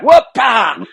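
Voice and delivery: a hammed-up grunt as you take the hit; mock grunt of impact. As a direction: grunt like a player getting flattened. Player grunts getting hit.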